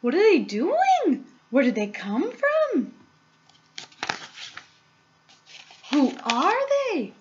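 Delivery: high, loud amazed cries; shouting. A narrator's reading. Crowd spotting little men across river, shouting. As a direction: shout over each other in astonishment. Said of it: "everybody started shouting at once".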